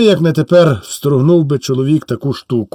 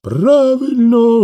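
high, slow, drawn-out cry of agreement; crowd shout; 8 semitones higher, much slower than usual. Acted. The crowd roaring approval at the soldier, high and drawn out. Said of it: "гукнув народ".

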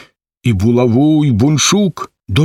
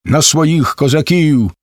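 plain reading voice

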